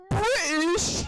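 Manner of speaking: squeaky rising mock astonishment; high-pitched meme impression. Retelling the British guy meme, high-pitched imitation. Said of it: "so high pitched at the end".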